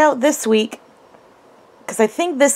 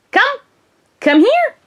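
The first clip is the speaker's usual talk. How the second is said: high-pitched recall to a dog; high dog-trainer's recall; calling a puppy; 12 semitones higher than usual. Real, not acted.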